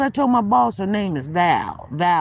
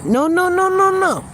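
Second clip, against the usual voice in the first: higher, louder string of firm noes; emphatic no; 10 semitones higher, 4 dB louder than usual. Retold or imitated. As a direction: shut the idea down hard, no after no. Insisting to the boss on the phone.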